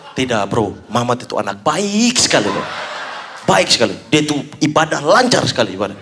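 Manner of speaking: low-pitched mock-earnest defence; membela sok tulus. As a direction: vouch solemnly for your own good name. Fake Ari praises himself. (memuji dengan nada rendah sok serius)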